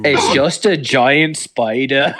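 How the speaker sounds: slow, hammed-up Australian bloke; mock Australian accent. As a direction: drawl it like a cartoon Aussie spotting a ghost. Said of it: "Australian accent".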